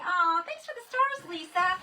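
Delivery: high, loud gushing thanks; delighted thanks. Real, not acted. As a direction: gush over a gift. Thanking a viewer.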